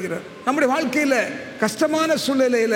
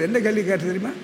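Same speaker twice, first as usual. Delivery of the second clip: ordinary talk, nothing marked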